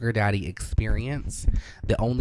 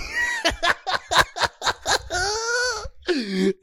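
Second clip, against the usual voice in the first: high shrieking laugh. Real, not acted. Laughs at own story.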